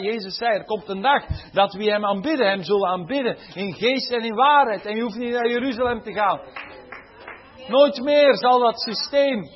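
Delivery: high, loud preaching, building with each halleluja; preaching at full force. Acted. Preacher proclaiming the cross to his congregation, loud and high.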